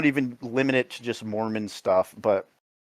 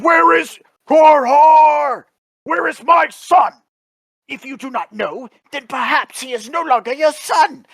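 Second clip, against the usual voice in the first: mock shout; 11 semitones higher, 5 dB louder than usual. Quoting the original film, high loud hammed shout. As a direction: bellow like a stiff movie father. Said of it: "in the original he's like".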